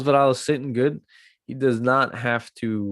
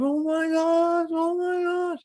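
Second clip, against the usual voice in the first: high, slow squeal of disbelief; amazed fan squeal; slower than usual. Retold or imitated. Fan squealing as the fighter wins. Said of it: "you're like".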